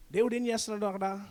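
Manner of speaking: ordinary talk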